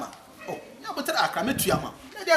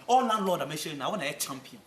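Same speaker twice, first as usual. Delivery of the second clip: usual speaking voice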